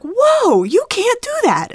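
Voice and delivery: high, loud mock alarm; imitated parental yelling. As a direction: call out a warning across the street. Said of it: "yelling at her across the street".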